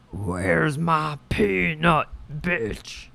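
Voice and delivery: slow put-on squirrel demand; drawn-out, lightly voiced critter. How she hears squirrels in her mind, slow put-on voice. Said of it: "that's how I hear them".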